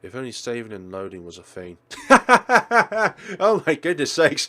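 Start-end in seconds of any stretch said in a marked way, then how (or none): 1.8-3.3 loud laugh. Real, not acted. The player laughing at the game's ending, loud. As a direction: burst of high, loud laughter.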